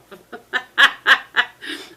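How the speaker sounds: a burst of giggles; laughing. Real, not acted. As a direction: laugh it off, amused. Laughing at husband's reaction.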